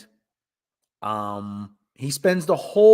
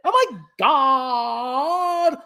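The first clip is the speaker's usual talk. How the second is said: put-on high, drawn-out Valley Girl wail; exaggerated Valley Girl dismay; Valley Girl accent; 4 semitones higher, much slower than usual. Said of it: "have a Valley Girl accent".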